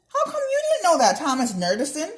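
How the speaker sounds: put-on high, loud teasing girl; mocking taunt. A narrator's reading. Ella taunts her nerdy friend, loud and high.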